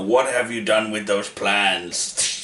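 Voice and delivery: slow, lightly menacing Vader imitation; a hint of Darth Vader, taken slowly. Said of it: "doing the whole, you know".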